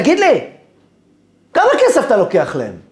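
loud, high-pitched accusing question; angry shouting. Retold or imitated. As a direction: yell the question at him. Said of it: "הוא פשוט צועק עליי". (צעקה כועסת ומאשימה)

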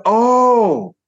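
loud, high, drawn-out retold "oh"; dawning realisation. Man realising the lady misread his compliment, sudden realisation. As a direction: stretch it out high as it dawns on you.

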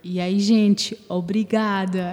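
slow thank-you in a Brazilian accent; drawn-out Brazilian Portuguese "obrigada". Shown as a demonstration. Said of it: "agradeça com sotaque brasileiro".

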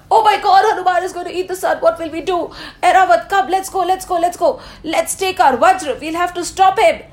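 high, fast, half-laughing panic; panicked rush. Acted. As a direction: blurt it out in a breathless, giggly alarm. Indradev panics about Hanuman.

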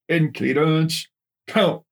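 high drawn-out shouted command; shouted order to count in cadence; cadence call. Acted. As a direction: call the count like a drill instructor. Drill instructor ordering cadence count.

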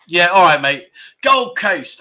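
normal talking voice

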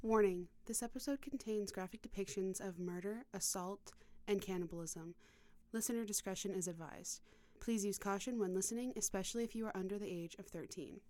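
quiet, even warning read; content warning read. Acted. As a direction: read the disclaimer calmly.

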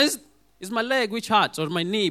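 ordinary talk, nothing marked

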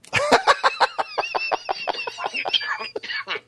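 high loud cackling laugh; big burst of laughter. Real, not acted. Cohost cracks up at naming [name], loud high laugh. Reacting to his own pick.

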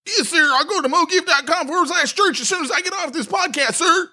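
high shouted yes-sir recruit; private's reply. Acted. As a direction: bark back at the sergeant. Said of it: "And you be Private Pyle".

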